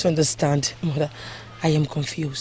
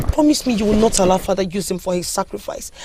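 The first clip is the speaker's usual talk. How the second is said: normal talking voice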